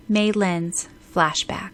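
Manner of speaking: ordinary delivery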